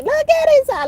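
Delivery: very high, loud delighted squeal; a thrilled high shriek; excited squeal. Real, not acted. Excited about the energy.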